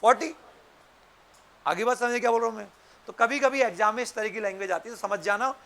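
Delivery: ordinary talk, nothing marked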